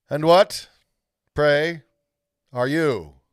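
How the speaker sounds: loud, high disdainful question; haughty queen. Acted. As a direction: look down on the boy as you ask. Haughty lady addresses Edmund.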